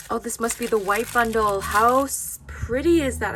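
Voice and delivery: loud, delighted gush; excited. Real, not acted. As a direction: burst out with delight.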